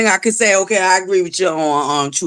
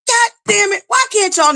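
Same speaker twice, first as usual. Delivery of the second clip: exasperated cry; 11 semitones higher than usual. Real, not acted. Streamer scolds viewers for few likes, high exasperated. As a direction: pitch jumps way up on God damn it.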